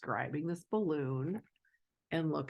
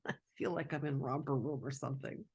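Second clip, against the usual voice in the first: plain reading voice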